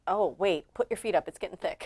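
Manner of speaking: lightly voiced impression, low and soft; Obama. Co-host mocking the clip's speaker, light Obama impression. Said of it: "that Obama voice that's like".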